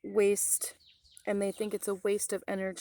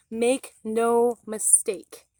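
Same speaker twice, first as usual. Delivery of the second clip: loud, slow, each word hammered; slow warning; 2 semitones higher, 7 dB louder, much slower than usual. Real, not acted. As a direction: make no mistake, laid down like a threat. Warning viewers after the code.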